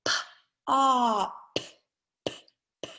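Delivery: "op" drawn out, then a short repeated "p, p"; slow sound pushing. Shown as a demonstration. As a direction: spell the sounds out one by one. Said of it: "saying those words very slowly".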